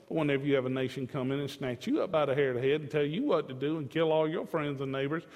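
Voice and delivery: normal talking voice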